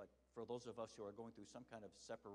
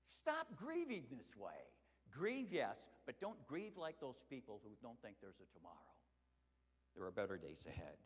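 earnest preaching; 6 dB louder than usual. Acted.

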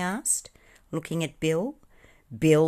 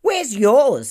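loud, high, cheeky comeback; rude retort; 11 semitones higher, 11 dB louder than usual. A narrator's reading. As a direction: throw it back rudely and loudly. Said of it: "said the pudding rudely".

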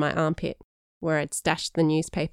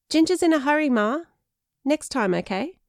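higher, louder call to Ma; calling to mum; 10 semitones higher, 4 dB louder than usual. A narrator's reading. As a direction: call out a quick excuse to mum. Danny calls out to his Ma.

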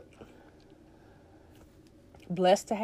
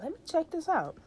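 high voice; 10 semitones higher than usual. Retold or imitated. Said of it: "I'm like".

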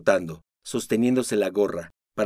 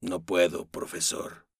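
soft, low, fading to a breath; 4 semitones lower, 5 dB quieter, slower than usual. Acted. Don Bernardo refusing the professor, quiet. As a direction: refuse almost under the breath. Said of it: "en voz baja".

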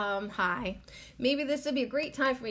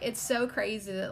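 normal talking voice

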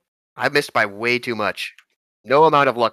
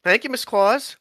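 high character voice; 10 semitones higher than usual. Acted. Characters thank Mrs Claus.

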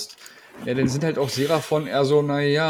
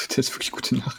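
breathy laugh through the words; 5 dB quieter than usual. Real, not acted. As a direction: giggle it out under your breath. Laughs along at the joke.

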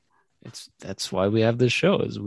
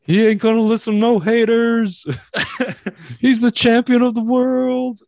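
hype announcer; 11 semitones higher, 7 dB louder than usual. Pretend. Hyping friend like a champion.

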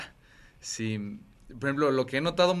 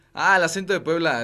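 put-on higher, louder snooty Puebla accent; mock posh Puebla; 6 semitones higher, 8 dB louder than usual. Chiapas friends mocking the Puebla accent, snooty. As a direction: sound like a stuck-up Puebla local. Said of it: "decimos como".

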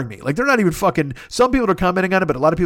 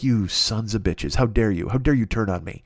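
mock betrayal; 6 semitones lower than usual. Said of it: "And I'm like".

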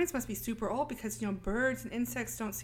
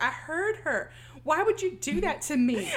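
hurt accusation; 6 semitones higher, 4 dB louder than usual. Retold or imitated. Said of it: "She's like".

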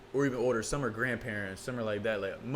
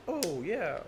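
lightly voiced, high mock chatter; a touch of parody of a dull talker; mildly mocking a pointless remark; mock small talk; 4 semitones higher, 3 dB quieter, slower than usual. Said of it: "I can't learn something where it's like".

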